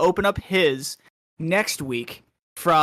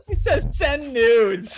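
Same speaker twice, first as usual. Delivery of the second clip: slow embarrassed reading; 9 semitones higher, 4 dB louder, slower than usual. Real, not acted. Reading embarrassing sign aloud.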